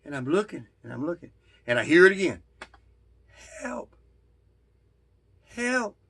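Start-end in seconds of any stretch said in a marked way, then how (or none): 3.6-6.0 high, soft calls for help from below; a friend calling up faintly from a cliff; far-off faint call. Retold or imitated. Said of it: "it sounds far off".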